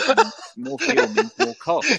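high slow laughing words. Real, not acted. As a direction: laugh out the punchline slowly. Laughing through logic.

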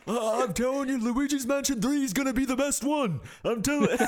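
very high, insistent politician impression; Bernie Sanders. Podcaster touts the new game, high mock-senator voice. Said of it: "work on our Bernie impression".

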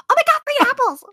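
mock her shrill excited squeal over free apples; annoying girl voice. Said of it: "the most, like, annoying voice".

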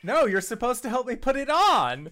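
high, loud objection; loud protest. Real, not acted. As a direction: cry out in playful protest. Player protests the joke at table.

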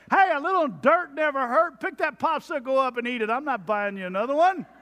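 high, loud fatherly scolding; dad voice. Retold or imitated. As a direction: scold the kids like an old-school dad. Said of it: "Or I'll say something like this".

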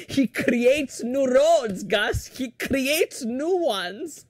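slow, drawn-out exasperated complaint; upset mom. Retold or imitated. Mom complaining about dad's driving, slow mock exasperation. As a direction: stretch it out in a high mock mom voice. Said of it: "my mom gets really upset at him".

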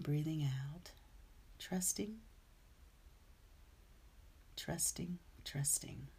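soft, hushed meditation guide; guided meditation. Acted. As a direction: ease them into stillness, softly.

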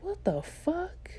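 high, drawn-out disbelief; retold bewilderment. Student asked boldly for number, high stunned reaction. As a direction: stretch it out, high and baffled.

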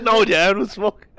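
high, loud, slow mock refusal; pleading son. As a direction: play a protesting kid, hammed-up. Acting the son refusing his dad.

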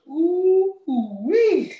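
a slow, high, stretched ooh-wee; drawn-out ooh-wee. Retold or imitated. Host recalling a near car wreck, a slow admiring ooh-wee. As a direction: savour it slowly and loud.

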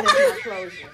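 high laughing punchline. Real, not acted. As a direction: laugh out the last words. Laughs at own threat.

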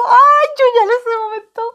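high, loud burst of excitement; excited outburst. Real, not acted. Reliving the peak of fear. (estallido alto y fuerte)

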